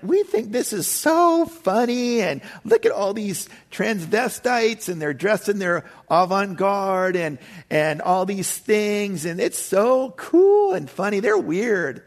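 high, slow, sneering mock voice of an amused tourist; imitating a snickering older couple; mocking onlooker. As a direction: camp it up as a scornful gawker. Mocking onlookers at street worship.

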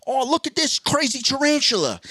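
high-pitched, excited Australian wildlife host; Steve Irwin. Acted. Wildlife host showing off a tarantula, high excited impression. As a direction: marvel at a deadly creature in an Australian accent. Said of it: "and he'd be like".

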